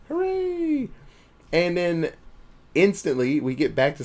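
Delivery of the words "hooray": very high sing-song hooray; hammed-up high-pitched hooray; mock high cheer.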